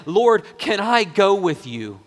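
loud, high-pitched earnest plea; impassioned humble request; pleading second criminal. Acted. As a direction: beg the Lord, drawing the words out. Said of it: "The second guy is saying".

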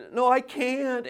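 high mimicked child's protest; child refusing. Daughter to insisting father, high stubborn refusal. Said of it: "she just simply says".

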